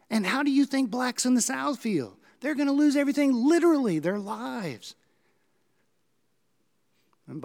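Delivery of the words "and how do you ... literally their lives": much higher, louder rising outrage; indignant brother. Retold or imitated. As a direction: hurl it at him, building to a peak. Clarence confronts his brother.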